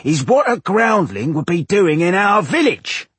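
high, loud, drawn-out villager jeer. A narrator's reading. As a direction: project it across the whole tavern. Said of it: "raising his voice so everyone in the tavern could hear".

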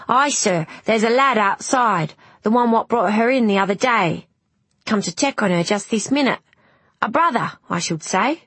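deferential crewman. Acted. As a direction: report helpfully to the doctor, as a humble crewman.

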